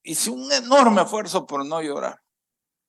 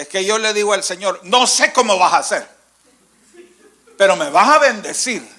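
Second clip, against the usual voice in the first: demanding believer; 5 semitones higher, 6 dB louder, faster than usual. Retold or imitated. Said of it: "yo he oído gente que dice".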